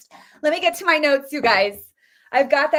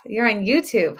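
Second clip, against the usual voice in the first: ordinary talk, nothing marked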